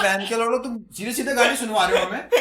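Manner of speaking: loud, high re-enacted protest with swearing; indignant outburst. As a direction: protest angrily, half amused in the retelling. Acts out angry retort to HR. (नाराज़ होकर ऊँची आवाज़ में विरोध का अभिनय)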